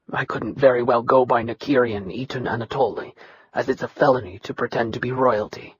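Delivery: low, soft, level voice of a bitter son; dry steady Nikirian. Acted. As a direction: hold down old hurt, flat and quiet. Nikirian answers with resentment.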